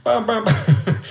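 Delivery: high imitated banging noises; comic sound effect of repeated thumps; banging imitation. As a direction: punch out each bang, high-pitched. Acting out banging on desk.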